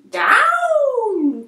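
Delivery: high, loud cry of down; urgent loud witch cry; cried out. A narrator's reading. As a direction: shout it down to the ground. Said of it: "cried the witch".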